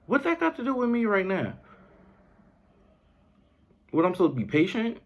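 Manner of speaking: put-on a thirsty kid pleading in a high voice; whiny kid. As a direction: play a five-year-old who is confused. Kid arguing with the parent.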